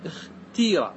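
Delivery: اختيرة said "ikhtiira", i then long ii; kasra form. Shown as a demonstration. Teacher contrasting vowel forms, اختيرة with kasra, "ikhtiira". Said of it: "بالكسر".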